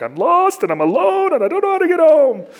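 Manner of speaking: high, loud mock wail of a lost man; helpless wail. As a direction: whine it like a lost, helpless kid. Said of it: "I call my wife and I'm like".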